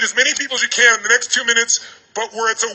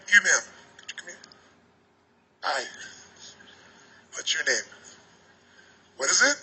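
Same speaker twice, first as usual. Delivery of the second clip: very soft, timid and halting, almost fading away; scared little kid; 6 semitones lower, 14 dB quieter than usual. Acted. Little kid greets a woman, afraid of rejection, acting painfully timid. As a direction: greet them as if dreading rejection, barely audible. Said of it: "like a little kid, you were so scared".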